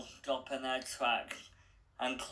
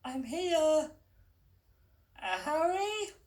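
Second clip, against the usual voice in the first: put-on much higher, unsure girl's voice; young girl Stella. A narrator's reading. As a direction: sound like the hesitant heroine. Stella's voice held.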